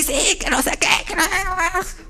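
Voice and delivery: high, muffled mock tantrum whining; tantrum whine. Believer throwing a tantrum before God, mock whining. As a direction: whine it like a sulking child throwing a fit. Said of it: "haciendo berrinche".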